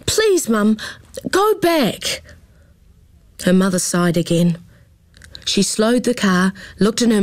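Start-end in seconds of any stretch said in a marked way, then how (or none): 0.0-2.2 high pleading go back. A narrator's reading. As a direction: beg her mother. Daughter begs to turn back.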